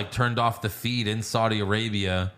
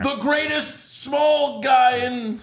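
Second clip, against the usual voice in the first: slow, higher, louder ring announcer; 13 semitones higher, 4 dB louder, much slower than usual. Pretend. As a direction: hype him like a ring announcer. Hyping the Hall of Famer.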